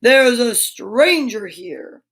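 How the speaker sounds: slow, weighty beast voice, a different timbre; slow beast voice for a child's bedtime story; booming beast voice. Acted. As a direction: loom over the room with each word. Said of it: "That's my booming voice".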